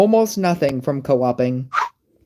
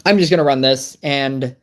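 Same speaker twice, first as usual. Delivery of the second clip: ordinary delivery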